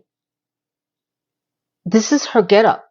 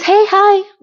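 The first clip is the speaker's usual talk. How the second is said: high, loud chirpy hello; bright cheery greeting; 11 semitones higher, 5 dB louder than usual. Retold or imitated. Neighbor greets Carrie with a chirpy high hello. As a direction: greet her sweetly and high.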